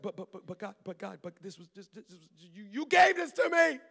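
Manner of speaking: mock pleading protest; protesting God, building from stammered quiet to high loud outburst. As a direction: sputter then burst out. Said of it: "I'm like".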